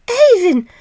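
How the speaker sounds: high, loud call of joy; excited cry. A narrator's reading. Marusha calling Ivan to see the living child, excited. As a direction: call your husband in wonder. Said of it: "cried Marusha".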